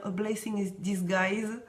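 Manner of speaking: English phrase said slowly with a French accent. Real, not acted. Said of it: "Avec mon accent".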